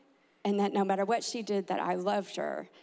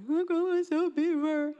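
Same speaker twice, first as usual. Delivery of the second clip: put-on high child-voice confession; pouting little girl; 6 semitones higher, 3 dB louder than usual. Girl confessing after timeout, high and childlike. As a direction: imitate a tearful child owning up. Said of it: "She said".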